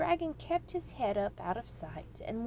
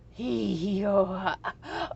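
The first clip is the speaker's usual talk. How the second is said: louder last hee hee ho ha; dying laughter; 6 dB louder, much slower than usual. A narrator's reading. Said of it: "went the dragon".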